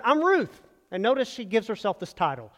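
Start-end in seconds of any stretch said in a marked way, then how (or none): 0.0-0.6 eager answer. Acted. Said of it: "And she answered".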